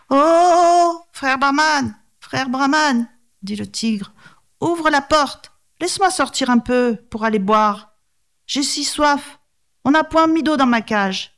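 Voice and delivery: voix aiguë et suppliante de tigre; tigre suppliant. Acted. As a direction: supplie d'une voix haute pour sortir. (tigre implorant d'une voix aiguë)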